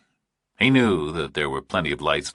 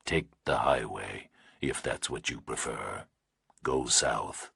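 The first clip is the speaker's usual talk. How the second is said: distorted nightmare voice; 6 semitones lower, 9 dB quieter than usual. A narrator's reading. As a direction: breathe it like a monster on the line. Kidnapper's distorted voice.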